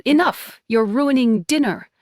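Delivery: loud, high mom's command; angry mother shouting at the table; mother yelling. Acted. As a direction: slam the words down like the napkin. Mother slams napkin, yells.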